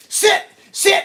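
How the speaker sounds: high, loud yelled command; retold yell. Dad yelling at the bolting dog, retold yell. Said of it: "He was yelling".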